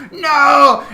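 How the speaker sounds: high, loud mock wail of loss; hammed-up despairing no; anguished no. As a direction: cry out as the loot dissolves. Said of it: "You're like".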